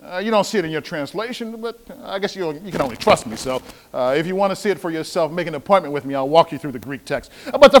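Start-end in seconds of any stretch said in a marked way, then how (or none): none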